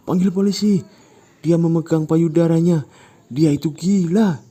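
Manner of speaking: high, loud bystander shouts; loud accusing crowd. Acted. Bystanders denouncing Ujang, loud accusation. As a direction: call for the police.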